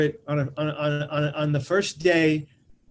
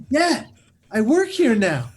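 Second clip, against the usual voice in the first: raised, insistent reply to dad; acted indignant announcement; protesting son; 7 semitones higher, 5 dB louder, slower than usual. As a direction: stretch it out, loud and defensive. Son answers furious dad, acted.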